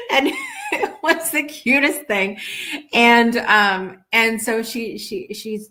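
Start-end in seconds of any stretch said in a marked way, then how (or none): 0.0-2.4 real laughter, higher and bubbly; laughing in delight over a sweet moment. Real, not acted. Vlogger recalling an old lady, laughing delightedly.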